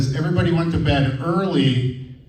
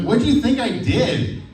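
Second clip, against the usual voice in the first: incredulous wife; 10 semitones higher than usual. Retold or imitated. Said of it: "She's like".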